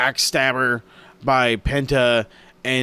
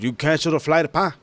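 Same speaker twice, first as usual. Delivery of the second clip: imitated Mexican accent, higher pitched; mock Mexican accent, final consonant swallowed; thick Mexican accent; 4 semitones higher than usual. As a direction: put on a heavy Mexican accent for the question. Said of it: "his Mexican accent is just so damn thick".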